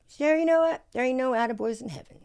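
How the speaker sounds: high, loud imitation of a blunt friend; imitated declaration. Friend lecturing her from the couch, loud imitated declaration. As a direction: announce it like the friend calling across.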